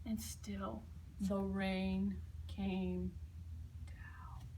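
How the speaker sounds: very slow, falling into a whisper; drawn-out rainy refrain. A narrator's reading. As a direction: stretch it out like steady rain.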